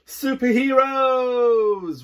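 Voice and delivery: high, loud, slow drawn-out yell. Acted. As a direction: yell it out as a battle cry. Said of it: "we all yell".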